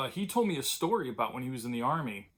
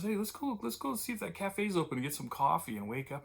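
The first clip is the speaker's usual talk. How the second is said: higher, lightly voiced eager friend; imitating Bill; 6 semitones higher than usual. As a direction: pitch up as your pal pitching the idea. Bill suggests cafe.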